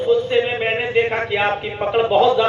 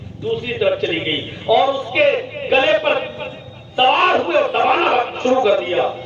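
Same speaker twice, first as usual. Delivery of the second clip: usual speaking voice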